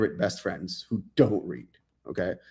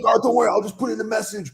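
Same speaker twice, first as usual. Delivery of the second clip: much higher, quick obliging voice; mock eager reply; 12 semitones higher, faster than usual. Author telling his friends he'll paste the story, high mock eager voice. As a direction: chirp like an eager helper.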